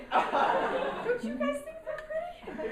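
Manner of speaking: high, slow question through giggles; laughing. Real, not acted. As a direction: beg the crowd while cracking up. Laughing at her own flashing bit.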